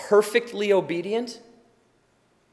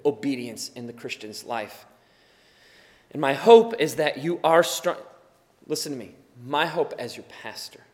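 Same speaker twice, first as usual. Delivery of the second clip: ordinary talk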